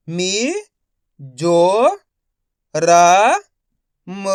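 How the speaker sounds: मी, जो, र, म held singly with long gaps; syllable-by-syllable reading. Shown as a demonstration. Teacher modelling for learners, मी जो र म one syllable at a time. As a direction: sound out मी... जो... र... म. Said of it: "एक एक अक्षर का उच्चारण करके".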